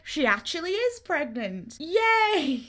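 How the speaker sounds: big hammed-up hooray; celebratory cheer. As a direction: whoop it out in mock celebration. Retold happy news, a cheer.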